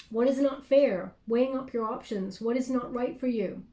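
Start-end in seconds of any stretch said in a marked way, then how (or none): none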